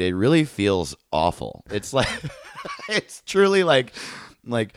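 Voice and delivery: usual speaking voice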